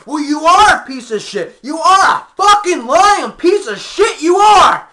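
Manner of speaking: high, very loud mock tirade; huffing, puffing rage. Boss raging at the employee walking out, huffing and puffing yell. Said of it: "he gets all friggin' huffing, puffing".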